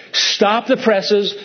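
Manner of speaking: slow, deliberate stop the presses; slowly, for emphasis; emphatic halt. Real, not acted. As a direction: slam the brakes on the story.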